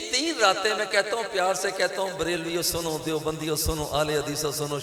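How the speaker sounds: usual speaking voice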